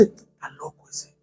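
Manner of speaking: very soft whispered aside; mock hushed mutter behind someone's back; whispered judgement. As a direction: whisper it like a gossiping onlooker. Voices onlookers whispering about him.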